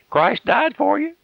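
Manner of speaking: Christ died for you lifted high and drawn out; high, slow, pleading repeat. Acted. Preacher pleading high and slow with the listening sinner. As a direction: stretch it out high, begging the sinner. Repeats it slower and far higher.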